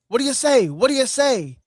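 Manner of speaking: loud, high mock promo shout; mock Piper mic call. Host mimics Piper interrupting Bret, loud and high. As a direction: ham up Piper barking into the mic.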